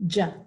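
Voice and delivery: "J" said as a short "juh"; isolated J sound. Shown as a demonstration. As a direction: pop out "J" as its sound, not the letter name. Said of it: "the J sound".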